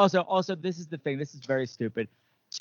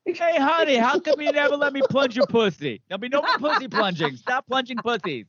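mock husband voice; 5 semitones higher than usual. Mock husband voice, plumbing joke.

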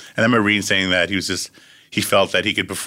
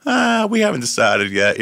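acting cool; 8 semitones higher, 3 dB louder, slower than usual. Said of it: "he's acting all cool again".

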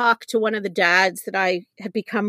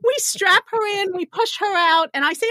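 ordinary talk

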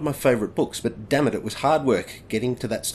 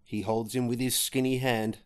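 dramatic poem recitation; 4 dB quieter than usual. Acted. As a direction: recite it like an old ballad on stage. Recites the poem's opening lines.

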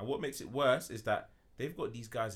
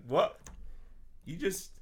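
shocked what; 10 semitones higher, much slower than usual. Retold or imitated. Shocked disbelief at a suspect confessing on TV. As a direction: react in disbelief. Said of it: "And I'm like".

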